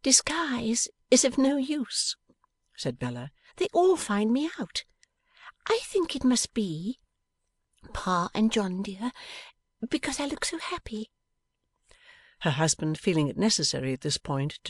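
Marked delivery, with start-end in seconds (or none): 0.0-2.2 put-on much higher, slow girlish voice; a bright young wife teasing her Pa; young Bella. Bella speaks to her father. 3.6-11.1 put-on a bright young wife teasing her Pa; young Bella. Bella speaks to her father.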